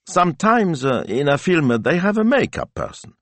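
high, hesitant daughter voice; carefully casual. A narrator's reading. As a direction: edge toward a request with studied casualness. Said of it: "her voice carefully casual".

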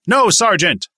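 yell at full voice. A narrator's reading. Recruit answering his sergeant, full-voice yell. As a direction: yell it as loud as you can.

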